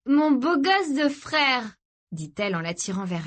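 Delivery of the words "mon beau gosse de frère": high, slow, doting croon; drawn-out and blissed out; high-on-ecstasy affection; dreamy sister. Acted.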